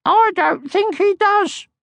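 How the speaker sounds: put-on very high, slow tiny girl voice; tiny cheeky creature voice; little Mai. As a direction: squeak it like a small bossy girl. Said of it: "said little Mai simply".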